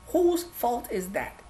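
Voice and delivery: quiet, slow repeat of the question. Acted. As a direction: drop the voice and linger.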